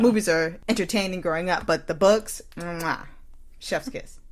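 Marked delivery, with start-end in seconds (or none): 2.6-4.2 smack a kiss then breathe out chef's kiss softly; mock gourmet kiss, almost whispered. Praising the books over the movies, a soft chef's kiss. Savoring the books like fine food.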